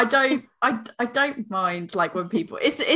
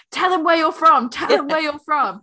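high, loud imitation of an egging-on friend; hammed-up hype-man heckle; mock hype man; 7 semitones higher, 5 dB louder than usual. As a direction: goad them on in a raised voice. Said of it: "like the worst hype man in history".

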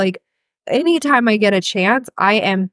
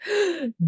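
sharp, exaggerated intake of breath; mock gasp; 8 semitones higher, 9 dB quieter than usual. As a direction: act out a shocked gasp. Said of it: "the comments that I got were like".

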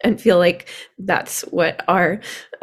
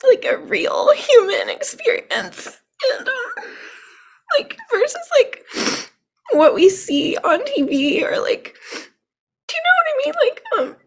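teary, much higher, laughing through tears; tearful. Real, not acted. Guest thanks host while crying and half laughing. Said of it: "trying to say this without crying".

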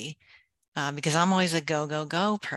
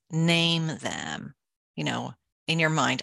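normal talking voice

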